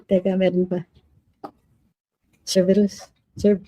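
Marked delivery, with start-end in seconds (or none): none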